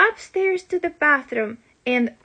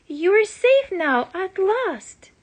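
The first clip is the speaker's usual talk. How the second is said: out of breath; 6 semitones higher than usual. Acted. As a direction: gasp it out after the climb. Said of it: "I panted".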